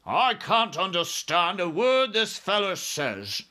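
loud, high irritated officer; snapped. A narrator's reading. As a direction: snap impatiently as army chief. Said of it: "the head of the army snapped".

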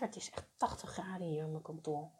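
talking right after a sip of water; words round a mouthful of water; while sipping a drink. Real, not acted. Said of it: "Ik neem even een slokje water". (net een slokje water genomen)

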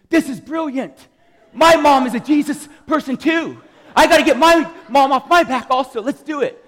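a buddy thrilled by the scheme; excited friend. Retold or imitated. Friend Puga loves the plan to fool moms, gleeful loud laughter. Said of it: "Puga's eyes light up".

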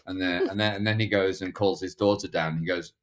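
ordinary talk, nothing marked

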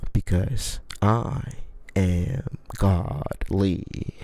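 hammed-up godlike pronouncement; mock grandiose. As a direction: savor a fake divine brag. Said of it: "Just kidding".

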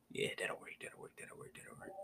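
unvoiced whisper to himself; half-whispered repeats under his breath; whispered aside. Real, not acted. Host whispering to himself while thinking.

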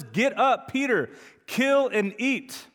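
high, slow commanding voice of God. Acted. God ordering Peter in a slow commanding voice. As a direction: command him from heaven, slowly. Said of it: "this is the voice of God".

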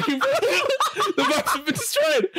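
very high retold yell through laughter; mock yelling. Upset gambler blames Ben after losing, laughing mock yell. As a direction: imitate an outraged outburst, laughing. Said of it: "You shouldn't be yelling".

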